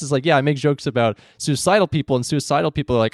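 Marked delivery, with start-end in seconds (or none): none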